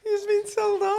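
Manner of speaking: high put-on teasing voice; high singsong told-off teasing; mock teasing voice. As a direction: tease in a high silly voice.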